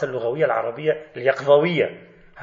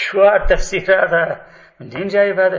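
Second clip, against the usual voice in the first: laughing disbelief; 4 semitones higher, 7 dB louder than usual. Real, not acted. Laughs at the interpretation. (سخرية ضاحكة مستنكرة)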